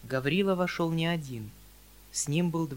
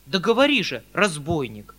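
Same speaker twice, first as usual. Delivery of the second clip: urgent; 4 semitones higher, 8 dB louder than usual. A narrator's reading. Uncle demands an answer.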